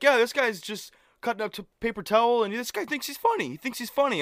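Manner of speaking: high-pitched retold outrage; mock indignant. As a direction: imitate a friend getting worked up. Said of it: "actually getting pissed".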